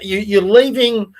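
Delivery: usual speaking voice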